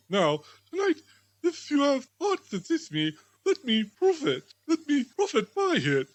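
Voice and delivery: a humbled miser begging to be taught; pleading Scrooge. Acted.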